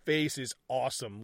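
ordinary talk, nothing marked

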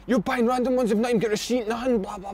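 high-pitched mock rant as the furious wife; mock angry wife. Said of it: "going mad".

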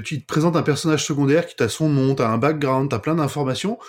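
normal talking voice

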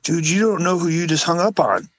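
high, exasperated mock telling-off; high retold outrage at the kid. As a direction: scold the kid in a high indignant voice. Yelling at kid who hung up.

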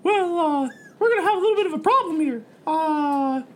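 high, hesitant mascot voice; worried cartoon mouse. First mate reports tentacle to captain, high cartoon mouse. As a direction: squeak out the bad news politely. Plays Mickey the rat.

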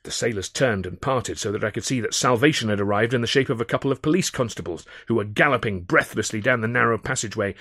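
plain reading voice

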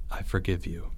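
low, hushed and tender; quiet, low absolution; solemn forgiveness. A narrator's reading. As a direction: forgive softly, gravely. Solemn forgiveness before leaving.

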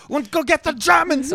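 high, pumped-up rallying cry; rally the troops, cartoon style; mock Chef Boyardee. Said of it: "Be like".

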